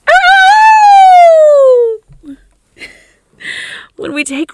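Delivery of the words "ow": high, loud, long wolf howl at the moon. Pretend. Coach urging listeners to howl at the moon, full wolf howl. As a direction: throw the head back and howl. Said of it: "howl at the moon".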